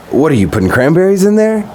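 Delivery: high-pitched, mock-frantic question; retold frantic. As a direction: imitate a flustered, disbelieving girlfriend. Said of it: "kind of a frantic, like".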